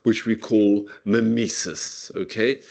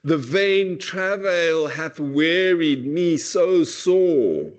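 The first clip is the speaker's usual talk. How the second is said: put-on slow and higher, 'The vain travail hath wearied me so sore' as a hurt young man; protesting lover; 6 semitones higher, much slower than usual. Shown as a demonstration. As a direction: plead it like a wounded young lover. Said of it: "by somebody actually protesting their disappointment in love".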